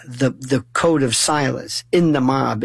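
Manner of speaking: usual speaking voice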